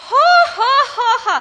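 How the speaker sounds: high, loud mocking children's laughter; ha-ha imitating a crowd of children; kids laughing. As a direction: cackle like a hall of kids. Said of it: "They were like".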